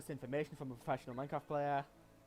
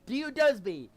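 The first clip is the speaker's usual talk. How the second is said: higher, louder joke yell; mock shout; 6 semitones higher, 12 dB louder, slower than usual. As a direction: yell the pun at a teammate. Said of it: "is say to him".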